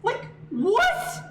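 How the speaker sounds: high, slow, baffled rant; incredulous disbelief. Real, not acted. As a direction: stretch it out in shocked disbelief. Ranting in disbelief at game.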